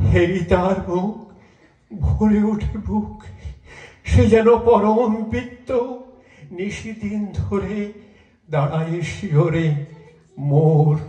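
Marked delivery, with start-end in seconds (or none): none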